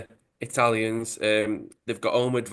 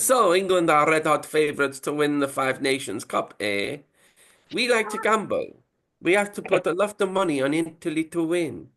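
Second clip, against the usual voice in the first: high mock Italian mobster; Italian accent; 6 semitones higher than usual. Italian accent, mafia boss proposing the bet. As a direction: ham up an Italian gangster pitch. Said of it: "it would be the same accent".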